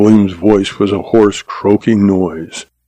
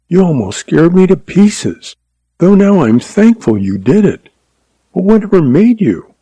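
put-on young wife's voice; 8 semitones higher than usual. A narrator's reading. Jean speaks, relieved and happy.

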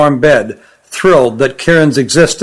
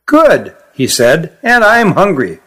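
put-on young creature voice; 2 semitones higher, slower than usual. Young Kirin tastes the pea.

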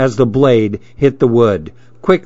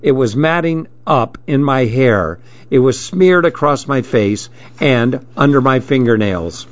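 ordinary delivery, nothing marked

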